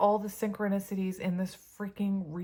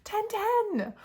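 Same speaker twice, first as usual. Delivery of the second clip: much higher amazed cry; excited squeal; 5 dB louder than usual. Real, not acted. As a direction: squeal the number in disbelief. Amazed at matching count.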